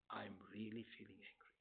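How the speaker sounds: hushed, calm whisper of the feeling; name your anger in a soft, calm whisper. Shown as a demonstration. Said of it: "It's a very calm voice".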